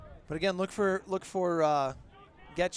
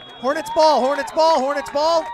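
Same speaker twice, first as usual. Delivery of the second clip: loud, high, urgent fan-commentator call; excited shouting; 6 semitones higher, 11 dB louder than usual. Real, not acted. Announcer claims a loose ball for the Hornets, excited shouting. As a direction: shout it louder with each repeat.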